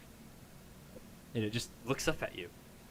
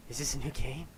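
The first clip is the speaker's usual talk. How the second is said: playful little creature; 4 semitones higher, 3 dB louder than usual. Acted. Weasel creature speaking.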